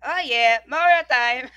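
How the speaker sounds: loud, high Italian Mario cry of "Mario time"; Mario accent. Acted. Gamer hyping himself up in a Mario accent. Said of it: "I hope I don't have to do the accent".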